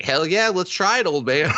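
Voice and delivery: put-on high, gleeful child; eager kid voice. Charlie answering the old clerk, gleeful and high. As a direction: pitch up like an excited kid.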